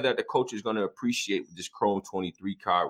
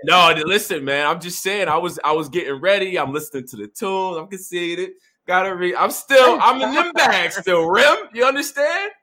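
high, loud excited rambling; 9 semitones higher, 8 dB louder than usual. Real, not acted. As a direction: get hyped and defensive. Excited defensive joking.

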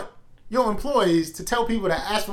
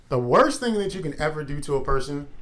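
normal talking voice

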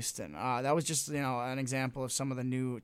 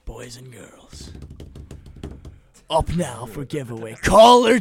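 announcer voice; 2 semitones lower, 9 dB louder than usual. Acted. Radio giveaway announcement.

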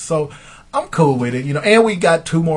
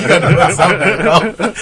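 louder, laughing take of the wife; laughing mock wife; 4 semitones higher, 5 dB louder than usual. Retold wife's demand, laughing. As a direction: repeat her line through laughter. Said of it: "She's like".

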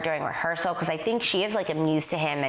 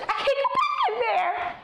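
upset dancer. Retold or imitated. Dancer voiced after storming out.

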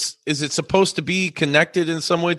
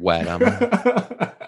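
laughing; 5 semitones higher, much slower than usual. Real, not acted. Repeats his joke, laughing.

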